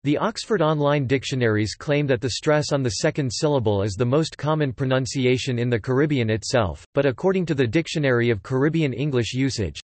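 usual speaking voice

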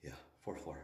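winded after four flights of stairs; out of breath from the climb. Real, not acted. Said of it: "the reason why I'm out of breath".

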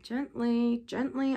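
soft, soothing murmur. A narrator's reading. Narrator murmurs to calm someone, hushed murmur. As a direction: murmur it gently, well under full voice. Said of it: "I murmured".

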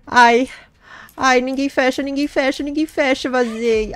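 high, loud gleeful squeal; squealing with excitement. Real, not acted. Gamer escaping the chasers, squealing with glee. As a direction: squeal it out as you slip away. Escaping, nobody closes door.

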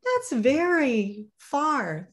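high and slow, drawn out in wonder; little hare impressed, slow high voice; high sleepy little hare. Acted. Little hare thinking sleepily, slow high voice. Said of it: "thought Little Nut Brown Hare".

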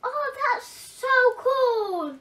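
high, slow, thrilled exclaim; excited. Real, not acted. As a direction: gasp then gush with delight. Child gasps at new toy.

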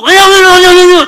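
high, very loud imitated babbling; forceful prayer. Boy praying too hard in tongues, forceful mock shouting. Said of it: "기도를 저렇게 세게 하니까".

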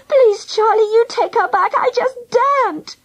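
high, desperate plea; begging. A narrator's reading. Girl too scared to return the baby, begging. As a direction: plead urgently in a high voice. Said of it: "she begged".

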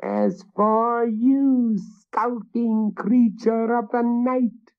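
high, slow furious hag; witch's cry. A narrator's reading. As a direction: spit contempt at him. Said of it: "cried the witch".

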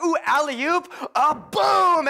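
loud, high, punchy exclamation; hyped-up, booming sports hype; excited play reenactment. Acted. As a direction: act out a slam dunk with gusto. Acts out exciting basketball play.

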